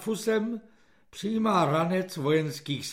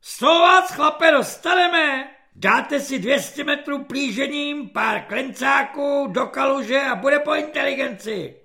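high, loud corporal's threat; ječivý svobodník; 7 semitones higher, 4 dB louder than usual. A narrator's reading. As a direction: screech punishment orders at a recruit. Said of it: "ječivým hlasem svobodníka". (ječivá svobodnická hrozba)

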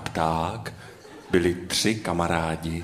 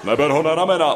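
higher, louder urgent warning; 7 semitones higher, 5 dB louder, much faster than usual. A narrator's reading. Bird Noach tells his friend not to lift him, warning. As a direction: warn your friend off, voice raised. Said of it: "varoval pták Noach".

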